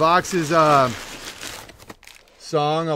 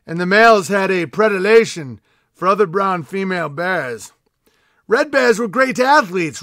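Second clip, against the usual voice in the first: raised, declaiming storyteller voice; mock-epic lore reading to the king; grand proclamation read; 3 semitones higher, 5 dB louder than usual. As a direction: proclaim it like a royal herald. Continues mock-epic letter reading.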